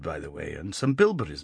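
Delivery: usual speaking voice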